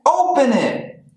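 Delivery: high, eager animal voices; excited animals. A narrator's reading. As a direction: animals urging a friend.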